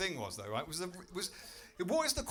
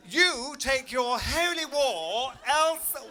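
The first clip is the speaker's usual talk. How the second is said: mock heroic; 7 semitones higher, 6 dB louder, slower than usual. Comic voices himself chasing terrorists.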